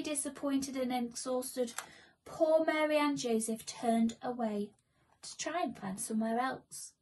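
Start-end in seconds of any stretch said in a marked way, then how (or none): none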